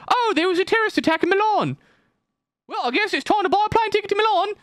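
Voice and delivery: absurdly high British caricature; mock British accent. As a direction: play a British tourist, pitched sky-high. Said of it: "even though he's British".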